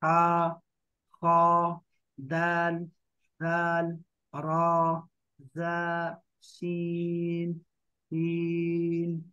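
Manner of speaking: letters stretched long, slow; drawn-out letter recital. Shown as a demonstration. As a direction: chant each Arabic letter slowly, lengthening the vowels. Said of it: "उसको थोड़ा सा लंबा करते हैं".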